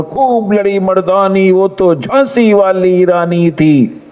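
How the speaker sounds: high, stretched-out heroic declamation; expressive poem recitation. Shown as a demonstration. Judge showing students how to recite, with expression. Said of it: "बिल्कुल ऐसे करके बोलना है".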